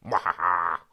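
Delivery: hammy villain cackle; evil laugh. Pretend. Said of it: "I love that evil laugh".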